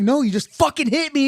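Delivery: loud, high-pitched indignant retort; outraged comeback. Retold or imitated. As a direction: re-enact yelling back at your attacker. Acting out his angry comeback.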